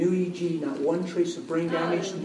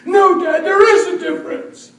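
high, loud protest; shouting boy; 12 semitones higher, 8 dB louder than usual. Retold or imitated. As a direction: shout it at your dad. Son shouts back at father.

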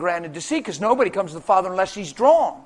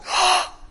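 put-on little girl's amazed gasp; sharp, acted intake of breath; imitated gasp; 10 semitones higher than usual. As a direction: gasp as a stunned child would. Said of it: "she's like".

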